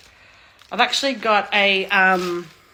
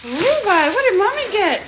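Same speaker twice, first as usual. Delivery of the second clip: high, sing-song pet voice calling the dog; pet talk to dog; 9 semitones higher, 4 dB louder than usual. Real, not acted. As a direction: mum asking her barking dog Levi what she got, high cooing pet talk. Mum coos at her barking dog.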